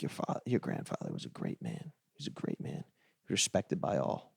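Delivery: hushed, solemn elder paying respects; old Italian man. Retold or imitated. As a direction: impersonate an old man's grave, near-whispered eulogy. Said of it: "this old Italian man came up to me".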